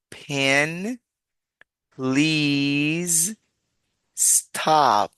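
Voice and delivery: "Pen, please, stop" slowly articulated with crisp P; teacher's word-by-word model of P in "Pen, please, stop"; stressed P sound. Shown as a demonstration. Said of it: "con ese mismo sonido".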